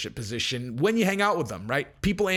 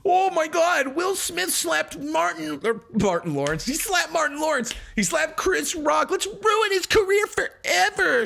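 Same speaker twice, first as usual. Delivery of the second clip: much higher, shrill mock-hysterical fan voice; mocking outraged fan; 11 semitones higher than usual. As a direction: mimic the pearl-clutching crowd, slowed and squealing. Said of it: "mocking outraged fans".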